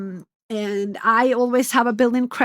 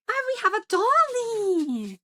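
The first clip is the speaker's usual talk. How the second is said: talking to a dog; 10 semitones higher than usual. Real, not acted. Greeting the dog.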